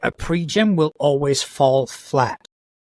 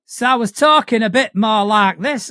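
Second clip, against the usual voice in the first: lightly dialled-up Yorkshire; Yorkshire accent; 6 semitones higher, 4 dB louder than usual. Shown as a demonstration. Player showing the game character's voice, slightly stronger Yorkshire. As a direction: lean a bit further into your Yorkshire accent. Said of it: "dial up the Yorkshire".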